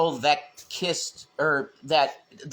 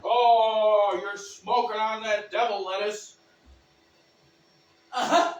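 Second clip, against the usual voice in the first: higher put-on voice, gently scolding; tut-tut like a pearl-clutching prude; lightly hammed-up pious scold; mock church lady; 5 semitones higher than usual. Mock prudish scolding of cannabis.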